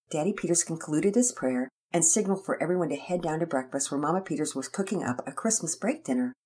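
ordinary delivery

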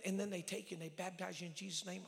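usual speaking voice

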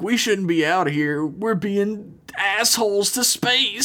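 hammed-up high, drawn-out lament; mock distraught rant. Acted rant of distraught Joe.

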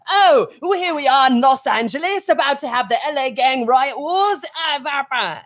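over-the-top mock British accent, high. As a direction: put on a pompous British accent for a future history show. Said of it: "So it'll even be like".

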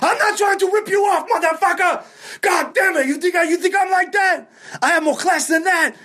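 very high, outraged yelling; Pete losing it. Retold or imitated. Club owner accused of shorting the comic's pay, imitated outraged yell. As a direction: explode in imitated wounded indignation. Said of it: "people lose their fucking shit".

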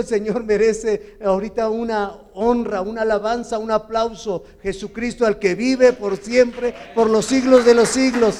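loud, ringing praise call to the congregation; booming pulpit exhortation; full-force preaching. Acted. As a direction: lift the room, declaiming praise.

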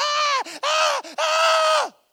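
very high, loud shrieking cries; imitated infant screams; baby wailing. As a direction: wail like the hungry newborn. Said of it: "te empieza a gritar".

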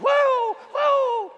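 high, loud hammed-up wail of "whoa"; mock bawling. Pastor mimicking the weeping lady with the beach towel, a loud high mock wail. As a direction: ham up the sobbing lady's wail. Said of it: "snotting and blowing".

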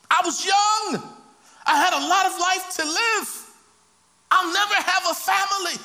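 high, shouted lament; anguished outcry. Acted. Murdered man protesting his lost life to God, anguished outcry. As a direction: cry out each grievance at a high pitch.

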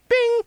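high-pitched imitated text-message ping; phone alert ding. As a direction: mimic a phone notification, very high.

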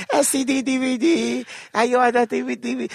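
mock DVD seller, high; mock Chinese seller. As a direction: hawk bootleg DVDs in a put-on accent. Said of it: "mock seller".

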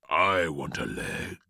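low-pitched monster voice, no shout; exclaiming cauliflower. A narrator's reading. Cauliflower claims its share of the boy, low exclamation. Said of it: "exclaimed the cauliflower".

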